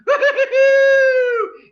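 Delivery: very high, loud shrieking laugh; overjoyed neighbor shrieking with laughter; ecstatic laugh. Acted. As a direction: shriek with wild joy. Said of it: "can't hide his excitement".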